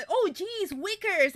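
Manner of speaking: put-on old-time American exclamation. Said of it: "cosas que se decían mucho antes". (exclamación de sitcom americana antigua, aguda)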